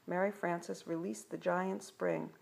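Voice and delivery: ordinary delivery, nothing marked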